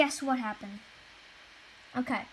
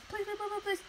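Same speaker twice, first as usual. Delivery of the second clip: high, soft whining please over and over; whiny begging; 9 semitones higher, 4 dB quieter than usual. Retold or imitated. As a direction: mimic a kid pleading in a tiny high voice. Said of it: "She was like".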